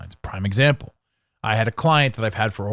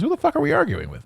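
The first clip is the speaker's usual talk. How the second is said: incredulous outburst; faster than usual. Retold or imitated. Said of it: "And then we think to ourselves".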